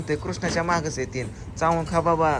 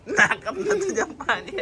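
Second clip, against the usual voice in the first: higher, laughing through the words; laughing while talking; 12 semitones higher than usual. Real, not acted. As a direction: laugh out the warning. Water up nose, laughing.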